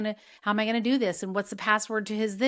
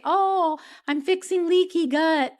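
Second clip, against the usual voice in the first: high, slow, smug mock voice; drawn-out sarcastic imitation of a know-it-all; mocking practitioner; 8 semitones higher, 5 dB louder, slower than usual. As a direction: parody a self-satisfied practitioner. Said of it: "practitioners who say".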